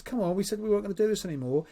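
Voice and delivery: acted partner's protest; exasperated coaxing. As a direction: hurry it out, mildly fed up.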